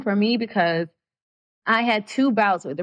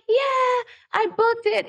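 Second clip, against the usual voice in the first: much higher, thrilled announcement; excited brag; 13 semitones higher, much slower than usual. Retold or imitated. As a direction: shout the good news with glee. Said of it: "I'm so excited".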